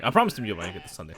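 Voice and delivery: ordinary delivery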